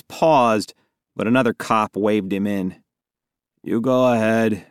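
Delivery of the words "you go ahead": ordinary delivery, nothing marked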